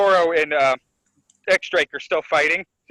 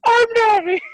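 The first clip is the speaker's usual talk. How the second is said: very high, loud mock voice; hammed-up high-pitched imitation; Nami; 6 dB louder than usual. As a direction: squeal it in a put-on cartoon voice. Voicing a character posing as Nami.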